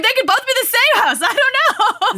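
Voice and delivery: high squeaky laughing voice; laughing disbelief. Retold or imitated. Retelling her reaction to the books, high giggling squeal. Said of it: "I was like".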